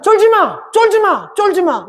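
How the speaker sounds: high-pitched, punched 쫄지 마 each time; insistent repeated urging. Retold or imitated. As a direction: drive home 쫄지 마 as separate high exclamations. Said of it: "계속해서 하나님께".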